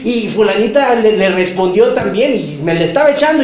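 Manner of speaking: high, loud, worked-up insistence; agitated insisting. Retold or imitated. Suspicious person insisting to a friend, high and agitated. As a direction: insist loudly that the words were aimed at you.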